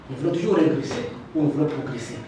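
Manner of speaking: ordinary delivery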